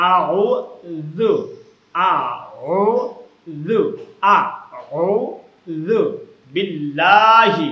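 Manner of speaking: very slow, sound-by-sound আউযু, then long loud বিল্লাহি; exact recitation. Shown as a demonstration. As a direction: squeeze the throat in আউযু and soften its "dhu". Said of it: "একদম সহি শুদ্ধভাবে উচ্চারণ".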